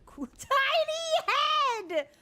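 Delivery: high, loud laughing shout; excited shout. Real, not acted. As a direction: cry out in delight. Delighted outburst at funny character.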